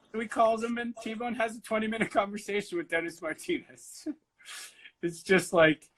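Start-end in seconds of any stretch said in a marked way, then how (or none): none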